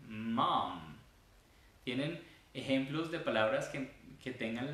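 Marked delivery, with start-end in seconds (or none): 0.1-0.9 "Mom" with long humming Ms; "Mom" stretched on its consonants; long vibrating M. Shown as a demonstration. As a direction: let the lips tickle on both Ms of "Mom". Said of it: "Sientan las cosquillitas".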